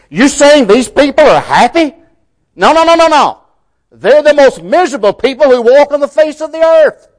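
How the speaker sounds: mocking the world. Preacher voicing the world's mockery of the Beatitudes, emphatic scoffing. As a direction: scoff like a disbelieving crowd. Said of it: "The world would say very emphatically".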